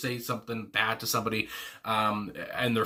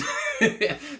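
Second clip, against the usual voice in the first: high laugh; 10 semitones higher than usual. Real, not acted. Laughs at [name] wanting hell.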